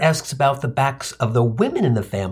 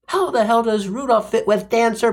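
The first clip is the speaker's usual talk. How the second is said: ordinary talk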